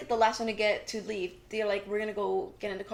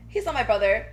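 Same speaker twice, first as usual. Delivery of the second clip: loud retold protest; raised voice, imitating an argument; insistent denial; 8 dB louder than usual. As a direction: act out a forceful denial. Retold quarrel over family ties.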